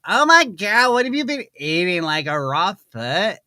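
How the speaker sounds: high, loud, mock-horrified outburst; disgusted date impersonation. Date recoiling from a partner's onion breath, mock horror. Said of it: "my impersonation of a date gone wrong".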